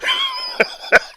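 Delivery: shrill helpless laugh; real giggle fit; high squeal of laughter. Real, not acted. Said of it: "I don't know why I'm laughing".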